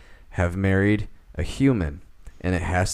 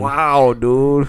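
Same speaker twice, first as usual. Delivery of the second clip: higher, louder mimicked exclamation; mock amazed imitation, pitched up; Chris Rock; 5 semitones higher, 5 dB louder than usual. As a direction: echo the catchphrase as an impression. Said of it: "I know, I did one earlier, too".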